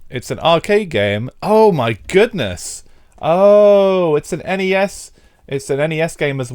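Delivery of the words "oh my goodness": ordinary talk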